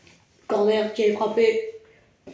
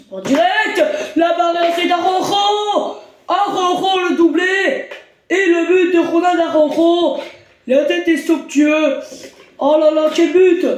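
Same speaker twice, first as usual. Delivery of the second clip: excited sports commentary; 6 semitones higher than usual. Acted. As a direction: shout the goal like live TV. Goal call commentary.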